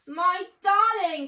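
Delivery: slow, high, loud overjoyed greeting; joyful cry. A narrator's reading. Mrs Fox welcoming her husband home, loud joyful cry. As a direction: pour relief into it. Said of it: "cried Missus Fox".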